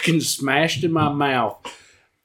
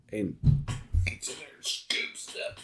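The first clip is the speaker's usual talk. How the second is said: unvoiced disgusted whisper; 6 semitones lower, 13 dB quieter than usual. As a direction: breathe it out in disgust.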